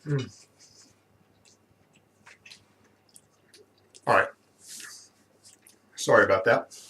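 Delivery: talking while chewing a bite; eating crunchy fried chicken. Real, not acted. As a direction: mouth full of chicken. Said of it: "Let me take a bite of my fried chicken".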